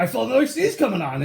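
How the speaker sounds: usual speaking voice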